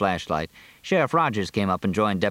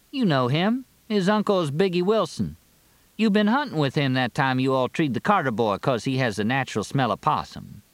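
high-pitched character voice for Carrie; folksy, teasing Carrie; 6 semitones higher than usual. Acted. Carrie reminds Fletch who Rondi is, folksy and teasing. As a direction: lift it well above the narration, chatty and teasing.

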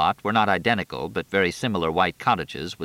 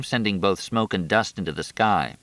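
ordinary delivery, nothing marked

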